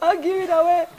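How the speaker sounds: high, loud, half-crying vow; mock eager promise. Retelling her promise to God, high and tearful. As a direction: squeal the promise. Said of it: "I'm like".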